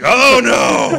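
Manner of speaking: loud, high mock cry of alarm; mock shout. Friend acting out alarm about Puerto Rico, mock shout. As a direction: yell it in fake panic. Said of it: "I'm like".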